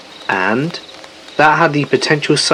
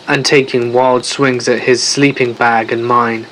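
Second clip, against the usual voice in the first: ordinary delivery, nothing marked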